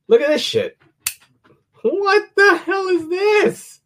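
high, slow amazed exclaim. Real, not acted. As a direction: marvel at the weird thing. Showing off weird knife.

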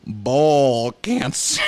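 very slow, drawn-out comic reveal; knowing punchline. Acted. Student mugging to the class to wreck a serious scene, knowing. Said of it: "turn to the audience and raise your eyebrows".

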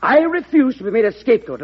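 wounded indignant outburst. Acted. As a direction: throw the refusal back at him. Secretary defies the president.

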